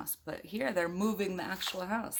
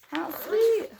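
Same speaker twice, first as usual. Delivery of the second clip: cooing; 14 semitones higher, 10 dB louder than usual. Real, not acted. Cooing over the happy ending.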